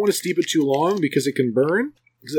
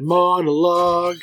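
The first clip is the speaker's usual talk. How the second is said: dramatic announcement; 3 semitones higher, slower than usual. Pretend. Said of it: "You can monologue".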